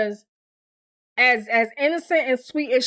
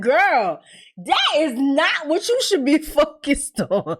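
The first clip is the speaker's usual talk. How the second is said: higher, drawn-out scolding breaking into a laugh; mock exasperated scolding; 6 semitones higher, slower than usual. Viewer scolding Keisha for her priorities, exasperated. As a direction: stress each word slowly in amused exasperation.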